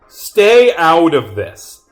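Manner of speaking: loud, slow, forceful order; heated, high and slow command; angry warning. Acted. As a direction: act a drawn-out shout to back off. Acted quarrel, warning off.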